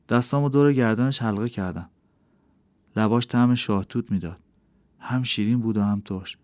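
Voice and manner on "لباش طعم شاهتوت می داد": plain reading voice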